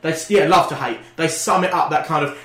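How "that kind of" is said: normal talking voice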